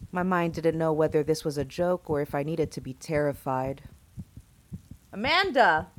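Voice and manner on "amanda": loud, high yell of "Amanda!", falling at the end. A narrator's reading. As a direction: yell out "Amanda!" for someone who won't answer. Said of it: "I yelled".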